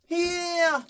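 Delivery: high 히야 pushed forward; pushed wrong accent. Shown as a demonstration. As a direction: shove the note like Superman. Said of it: "이렇게 하는 거죠".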